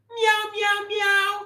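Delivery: very high put-on kitty meows; cat meow. Cat comedian in his painting meows, high and cute. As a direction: mew like a cute cartoon cat.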